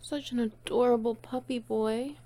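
normal talking voice

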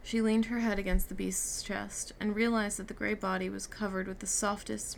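ordinary delivery

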